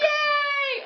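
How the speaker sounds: high, loud, drawn-out cheer; mock group cheer. Heroes respond to the headmaster's thanks, cheering loudly. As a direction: let out a gleeful hooray.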